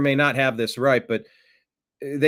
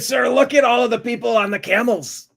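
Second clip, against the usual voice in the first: high, loud acted voice; put-on voice; 11 semitones higher, 5 dB louder than usual. Aide pointing out camel riders to the boss, high put-on voice. Acted exchange in a Trump-style joke.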